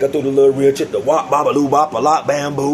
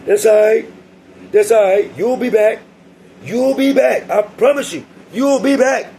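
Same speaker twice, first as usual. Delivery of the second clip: much higher, taunting singsong; mocking guard; 9 semitones higher, 3 dB louder than usual. Guard mocking the leaving inmate, high and taunting. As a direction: needle him, repeating it higher each time. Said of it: "He talking about".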